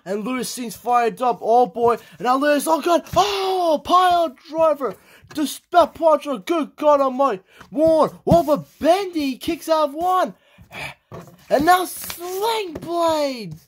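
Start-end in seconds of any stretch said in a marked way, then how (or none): 2.2-13.6 high, screaming commentator hype; excited play-by-play. Acted. Commentator losing it as Lewis fights back, high and frantic. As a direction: call the comeback like the crowd is on its feet.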